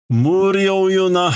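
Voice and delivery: voiced manga line. Reading manga lines in character.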